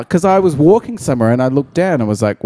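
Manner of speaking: normal talking voice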